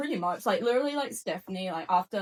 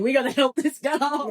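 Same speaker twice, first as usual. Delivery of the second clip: higher, louder rescuing colleague; mock PR voice; 7 semitones higher, 8 dB louder than usual. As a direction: swoop in like PR damage control. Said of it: "She came in with the P R going".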